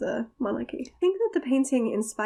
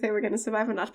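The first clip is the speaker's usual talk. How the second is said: usual speaking voice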